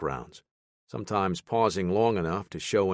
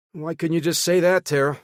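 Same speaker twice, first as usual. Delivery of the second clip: exasperated; 11 semitones higher, 6 dB louder than usual. Acted. Baker exasperated at jargon.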